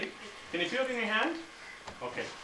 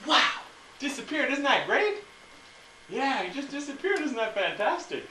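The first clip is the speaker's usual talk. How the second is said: put-on kids party magician; 8 semitones higher than usual. Mock amazement for kids.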